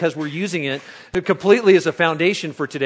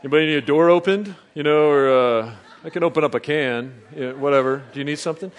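macho guy after movie; 3 semitones lower than usual. Retold or imitated. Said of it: "you're like".

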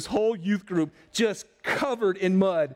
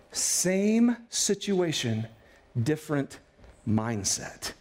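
very slow, dropping lower; slow emphatic refrain; 5 semitones lower, much slower than usual. Shown as a demonstration. Pastor repeating his refrain, very slow and low. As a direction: linger on each word.

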